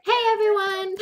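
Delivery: high, loud show-opening hello; excited greeting. Real, not acted. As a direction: sing out a big hello to the audience.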